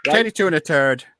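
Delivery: put-on Irish brogue; mock Irish accent. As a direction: exaggerate the Irish accent on the numbers. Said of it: "we sound like Darby O'Gill".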